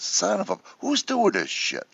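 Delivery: high-pitched, drawn-out retold outcry; mock exasperation. Retelling pranks played on him, exasperated. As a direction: re-enact a prank victim's indignant complaint.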